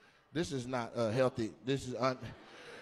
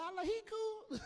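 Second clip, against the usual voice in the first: high, squeaky quote of her; a high, giggly mock of her answer; mock girly voice; 11 dB quieter than usual. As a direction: play her as airy and offhand. Quoting a woman's flat answer.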